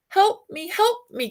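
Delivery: a high mock cry for rescue; cries for help. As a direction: call out like someone in trouble.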